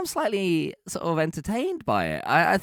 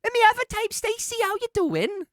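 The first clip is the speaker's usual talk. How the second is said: tiny high Welsh voice; Stacey-style Welsh accent. Acted. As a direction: do a delicate, high-pitched Welsh woman. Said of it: "it's basically Stacey and".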